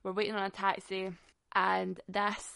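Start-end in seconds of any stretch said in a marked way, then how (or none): none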